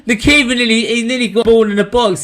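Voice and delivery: high, excited recap; amazed excitement. Real, not acted. Fan amazed the keeper nearly assisted, excited. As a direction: gush it out high and loud.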